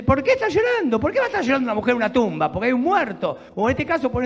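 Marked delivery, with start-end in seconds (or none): 0.0-1.9 incredulous question. Acted. Preacher mocks the angels' question.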